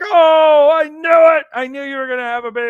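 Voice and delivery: loud, high burst of joy that rushes on fast; a husband shouting with joy at the pregnancy news; excited shout. Retold or imitated. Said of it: "and so we're excited".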